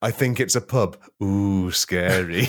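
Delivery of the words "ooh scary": mock scared. Mock fear at pub.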